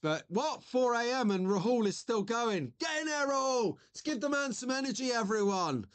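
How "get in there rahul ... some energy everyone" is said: higher, quick, hyped-up call to the chat; rally everyone behind him; a pumped-up streamer cheering a viewer on; excited cheer. Real, not acted.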